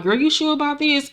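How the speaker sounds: hesitate, worried; nervous doubt. Retold or imitated. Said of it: "So Janine is looking like".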